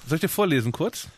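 usual speaking voice

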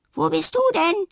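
echo. A narrator's reading. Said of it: "echot es da zurück". (als Echo zurückhallend)

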